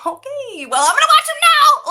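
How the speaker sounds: high, loud comic exclamation; hammed-up flustered outburst; mock indignant retort. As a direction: rise from high okay to a shout. Her retort to the sarcastic co-star.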